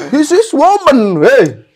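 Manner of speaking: very high, slow, loud falsetto; hammy falsetto diva impression; [name] voice. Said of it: "udah jelas suara-suara dia".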